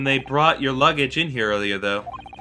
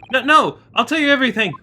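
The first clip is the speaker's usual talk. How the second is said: panicked plea; 9 semitones higher, faster than usual. Acted. Prisoner pleads under threat.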